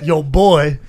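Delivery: exaggerated Southern drawl, loud. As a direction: imitate a drunk Southerner, booming it out. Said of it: "Total redneck shit".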